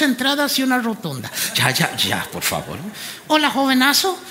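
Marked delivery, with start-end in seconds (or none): 1.5-2.8 low, different voice begging them to stop; mock pleading. Host to laughing crowd, low mock pleading voice.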